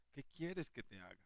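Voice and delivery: Jesus tenderly asking the blind man what he wants. Acted. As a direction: ask it with tenderness, almost hushed. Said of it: "más de ternura".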